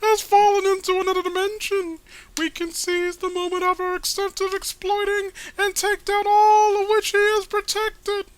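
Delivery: orate like a pompous cartoon bad guy; high grand villain proclamation to his brethren; Paul Bear voice. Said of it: "Paul Bear voice".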